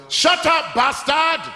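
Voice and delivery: hammed-up dad yell; mock angry shout. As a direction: snap it at the kid like a furious dad. Dad yells at child.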